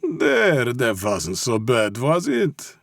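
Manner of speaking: put-on high-pitched, patronizing Russian accent; mock-comfort him in a Russian accent; soothing Russian mob boss. A narrator's reading. Mob boss claps confessed captive's shoulder, soothing.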